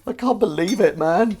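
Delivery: high, loud incredulous cry; mock disbelief. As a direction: burst out in put-on disbelief.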